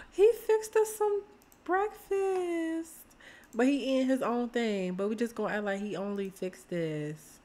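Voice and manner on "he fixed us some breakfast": much higher, surprised and thrilled; delighted gasp. Real, not acted. Gasps at breakfast.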